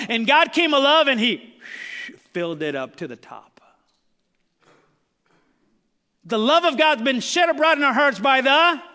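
loud, rising pulpit preaching; full-throated sermon delivery; fervent preaching. Acted. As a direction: preach it out to the whole room.

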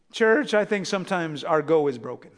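usual speaking voice

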